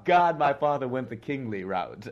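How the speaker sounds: laughing out loud through the words; high, loud, real burst of laughter. Real, not acted. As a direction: crack up while talking. Laughing about his near-miss name.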